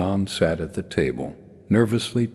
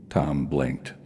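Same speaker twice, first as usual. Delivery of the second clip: usual speaking voice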